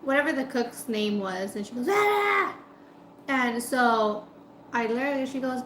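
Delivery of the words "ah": high-pitched imitation holler; yell. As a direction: holler across the shop, mimicking her. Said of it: "she yells".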